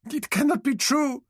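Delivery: high tearful disbelief; sobbing. A narrator's reading. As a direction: weep the words out. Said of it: "Tesina sobbed".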